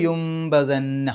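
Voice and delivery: യുംബദന്ന very slow, lips closing gently on m; careful tajweed demo; slow iqlab demo. Shown as a demonstration. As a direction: recite യുംബദന്ന slowly with a humming m. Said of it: "നൂനിൻ്റെ ശബ്ദം മണിക്കുക".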